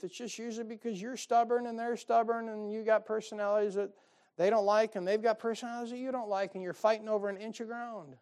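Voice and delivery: normal talking voice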